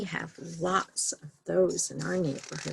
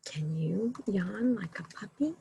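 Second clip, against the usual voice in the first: whisper; 5 dB quieter than usual. Acted. Hushed bedtime question to children.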